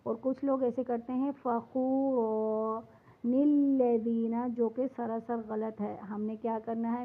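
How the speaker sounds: ordinary talk, nothing marked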